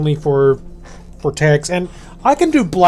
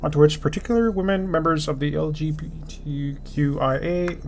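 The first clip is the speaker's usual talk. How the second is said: reading aloud; 8 dB quieter than usual. Acted. Reads Twitch policy aloud.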